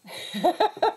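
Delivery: short high giggle at her own slip; embarrassed laugh. Real, not acted. Crafter laughing at her own stamping mistake, giggling.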